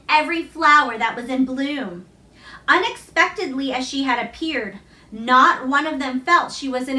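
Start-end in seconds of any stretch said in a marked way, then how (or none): none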